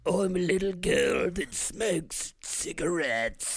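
put-on slow, croaky possessed girl; poltergeist voice. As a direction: croak like a smoking ghost child. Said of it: "I can try it".

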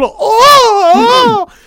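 high loud hammed-up moan; high mock moan. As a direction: squeal it out in mock pleasure. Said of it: "she's just going".

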